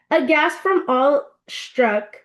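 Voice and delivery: ordinary delivery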